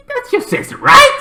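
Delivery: very high, sneering question; taunting jab. Retold or imitated. Sister taunting the brother, high mocking jab. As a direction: needle him with a high mocking right.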